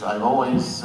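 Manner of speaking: ordinary delivery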